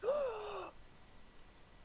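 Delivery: big high gasp of wonder; mock kid gasp; amazed gasp. Kids amazed by water trick.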